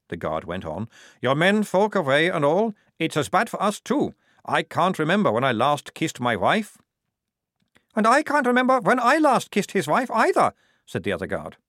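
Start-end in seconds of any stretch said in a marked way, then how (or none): 1.2-6.8 higher, louder leering soldier voice; a sly guard fishing for company. A narrator's reading. Guard leaning toward the trapped women, higher and leering. 7.9-10.5 much higher, louder joking guard voice; second guard joking. A narrator's reading. Second guard cracking a joke about the other's wife, high and loud.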